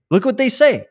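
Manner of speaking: exasperated outburst. Real, not acted. Outburst at article.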